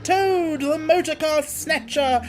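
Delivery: high, loud, slow bragging roar; boastful shout. Acted. Toad boasting wildly to his passengers, loud and slow. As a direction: crow your own glory. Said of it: "he shouted recklessly".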